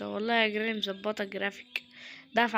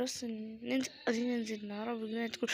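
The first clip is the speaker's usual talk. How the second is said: ordinary delivery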